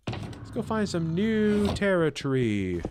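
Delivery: lightly put-on British accent; a playful hint of an English accent. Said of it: "Rate my British accent".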